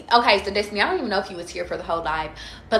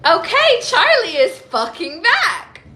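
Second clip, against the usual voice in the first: high, loud, laughing and slow; laughing triumph; 10 semitones higher, 8 dB louder, slower than usual. Real, not acted. Celebrating to viewers that she is back, loud laughing joy. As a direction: crow it with a laugh. Celebrating her recovered mood.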